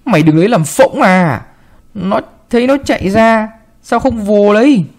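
put-on angry grandma berating the servant girl; scolding harshly. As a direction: tear into the little maid. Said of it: "hoạnh".

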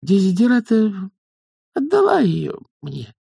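high, halting confession; timid young witch. Acted. Young witch confesses to her elders, timidly. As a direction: admit it in a small high voice, pausing.

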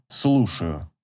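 low, curt unfriendly answer; a hint of cold phone pickup. A narrator's reading. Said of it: "неприветливое". (неприветливое «слушаю», низко)

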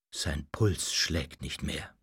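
low, soft, slow and grave; mournful announcement. A narrator's reading. Doctor pronounces the master dead, mournful. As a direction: announce a death in a hushed, sorrowful tone. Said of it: "verkündete er mit Trauermiene".

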